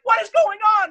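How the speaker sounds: high, loud alarmed cry; shocked mom. Retold or imitated. Mom filming her son's arrest, high loud alarmed.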